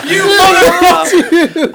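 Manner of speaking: high, drawn-out mock-outraged yell; retold outraged shout. Friend learns who was trolling him, outraged shout. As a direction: yell it in gleeful disbelief, slow and loud. Retold outburst when the culprit confesses.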